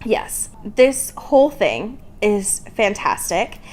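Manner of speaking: ordinary talk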